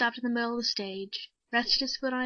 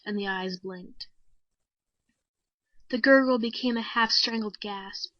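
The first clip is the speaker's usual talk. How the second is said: usual speaking voice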